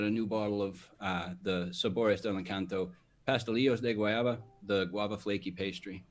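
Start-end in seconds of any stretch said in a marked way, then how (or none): 1.7-4.4 fluent Spanish pronunciation; Spanish accent. Real, not acted. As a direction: roll the names off in easy Spanish. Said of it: "Spanish accent".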